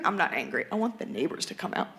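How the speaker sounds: ordinary talk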